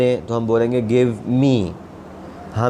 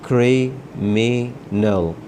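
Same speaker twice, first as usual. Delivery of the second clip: Criminal stretched out, each sound clear; slow careful syllables; much slower than usual. Shown as a demonstration. Teacher spelling out criminal slowly for students.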